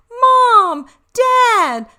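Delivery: high, loud joyful cry; excited shout. Acted. Dogs spotting Mom and Dad, shouting high and loud. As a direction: burst out with relief.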